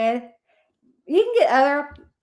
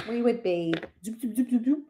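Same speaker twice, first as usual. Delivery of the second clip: mock line noise; 4 dB quieter than usual. Imitates a telephone line noise.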